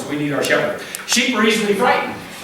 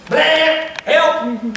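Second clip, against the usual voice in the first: mock bleat then a cry of help, pitched up; sheep bleat for help; 7 semitones higher than usual. Stuck sheep crying out.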